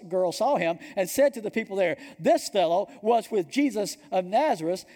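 loud, high-pitched scripture reading; forceful preaching. Acted. Preacher reads Peter's denial to the congregation, loud and forceful. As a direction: proclaim the story at full force.

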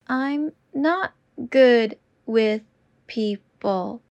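slow and deliberate. Shown as a demonstration. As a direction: stretch each word out. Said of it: "repetition drill, slowed take".

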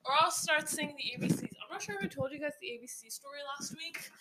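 ordinary delivery

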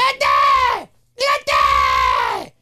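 loud hammed-up cry of go; mock soap-opera yell; dramatic shout. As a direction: bellow it twice like a soap opera.

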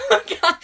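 high, loud giggle fit; high burst of laughter. Real, not acted. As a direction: crack up laughing. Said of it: "without laughing".

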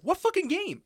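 high, loud, indignant mimicry; exaggerated squawk of disbelief; mocking imitation. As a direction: mimic a friend's baffled outburst. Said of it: "You're like".

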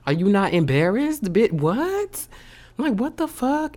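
high-pitched, outraged disbelief; incredulous indignation. Real, not acted. Venting at whoever kept using the stolen card, incredulous. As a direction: voice leaps up in baffled outrage.

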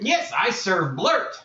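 high, slow goblin answer; a proud little goblin servant. Acted.

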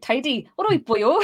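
high-pitched, put-on Welsh lilt; Welsh accent. Shown as a demonstration. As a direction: warm up a playful Welsh accent with stock phrases. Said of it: "get the, the accent in there".

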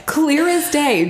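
ordinary talk, nothing marked

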